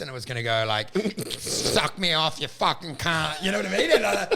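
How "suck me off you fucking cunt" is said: slow, low synthetic reading; text-to-voice. Retold or imitated. Comic replaying his AI bit, slow text-to-voice delivery. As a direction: drag it out like a computer reading aloud. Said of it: "did text to voice".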